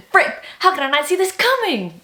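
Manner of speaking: loud, high cry of disbelief; shocked outburst. Real, not acted. Reader hit by an unexpected twist, loud shocked outburst. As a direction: kick yourself for missing it.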